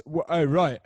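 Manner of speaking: usual speaking voice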